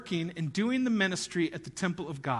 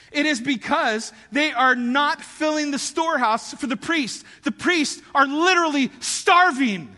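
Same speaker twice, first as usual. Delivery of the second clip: building to a loud, higher cry on starving; impassioned preaching; 5 semitones higher, 6 dB louder than usual. Real, not acted. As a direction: pound it home, louder each line. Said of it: "I've never heard anybody ever explain".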